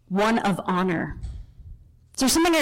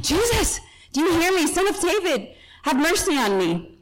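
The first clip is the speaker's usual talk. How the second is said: high, urgent retold cry, little added volume; more fired-up yell; 7 semitones higher than usual. Blind beggar calling out harder to Jesus, fired-up mock yell. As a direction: plead over a crowd, pitch jumping up then easing. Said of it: "He yells out even more".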